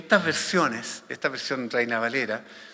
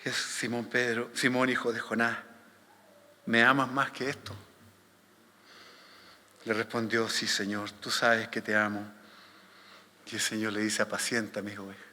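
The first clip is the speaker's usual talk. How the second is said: low, soft Gospel reading; solemn scripture reading; 4 semitones lower, 5 dB quieter than usual. A narrator's reading. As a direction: read it hushed and low. Reads the Gospel passage aloud. (lectura bíblica solemne, grave y suave)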